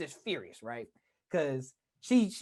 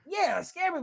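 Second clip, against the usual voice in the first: ordinary delivery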